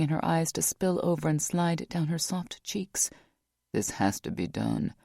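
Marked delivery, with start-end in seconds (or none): 3.7-4.9 deep, quiet stop; lower, gently halting her; Rock, low and firm. A narrator's reading.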